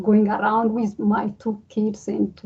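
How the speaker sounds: ordinary delivery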